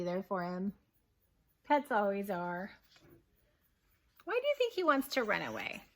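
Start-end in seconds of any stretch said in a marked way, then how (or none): none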